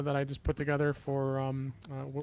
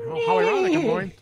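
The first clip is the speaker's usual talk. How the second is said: squeaky, much higher and louder; high squeal; 10 dB louder than usual. Real, not acted. Sudden high squeal, laughing.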